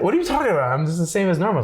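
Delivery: high-pitched, retold bewilderment; baffled protest. Retelling his reply to a critical date, high baffled protest.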